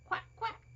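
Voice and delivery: high mock quack quack; duck quack. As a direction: play the duck, high and cute. Voicing the toy duck.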